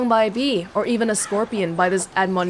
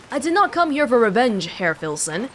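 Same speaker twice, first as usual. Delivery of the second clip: ordinary talk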